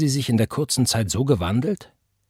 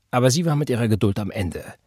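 plain reading voice

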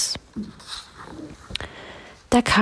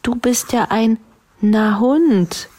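higher-pitched cry of discovery; calling out; 6 semitones higher, 3 dB louder than usual. A narrator's reading. Neinhorn naming the dog it met, calling out high. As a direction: call out the realisation. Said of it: "rief das Neinhorn da".

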